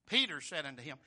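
normal talking voice

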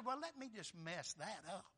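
quiet scheming. Retold or imitated. Preacher voicing the devil eyeing their prayer time, quiet scheming. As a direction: slip it in softly like a schemer. Devil's sly aside, retold.

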